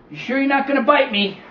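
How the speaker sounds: put-on high, doubtful boy's question; boy checking whether the snake will bite, wary. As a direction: ask it like a suspicious kid.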